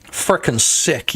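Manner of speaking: slow, drawn-out catchphrase. Shown as a demonstration. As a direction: drag out frickin' sick slowly. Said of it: "this has been my stim lately".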